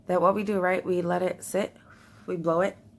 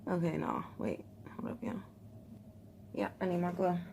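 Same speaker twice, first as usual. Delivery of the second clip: usual speaking voice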